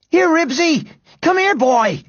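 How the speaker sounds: high-pitched frantic shout to a dog; frantic call. A narrator's reading. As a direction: call out in panic for your dog. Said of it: "called Henry frantically".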